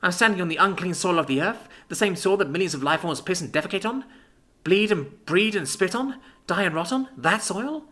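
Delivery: horrified. Acted. Libby horrified at outside.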